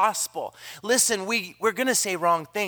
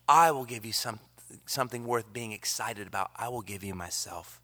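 soft voice of Jesus; 8 semitones lower, 3 dB quieter than usual. Acted. Jesus voiced calling people back.

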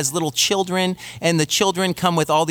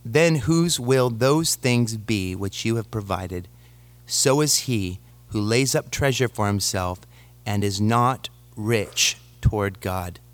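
measured, lower Bible reading voice; scripture reading; 4 semitones lower than usual. Acted. Pastor reads Luke aloud.

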